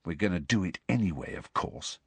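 soft, quick, confidential; hushed and matter-of-fact; quietly. A narrator's reading. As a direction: keep it down so nobody overhears. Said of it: "he said quietly".

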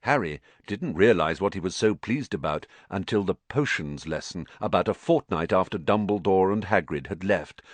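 usual speaking voice

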